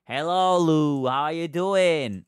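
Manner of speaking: high, loud, slow sing-song hello; upbeat stream welcome; cheery greeting. Real, not acted. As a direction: greet them brightly, stretching it out.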